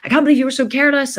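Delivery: angry father scolding his daughter over the vase; mock shouting dad. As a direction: rant at her like an angry dad. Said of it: "he started shouting".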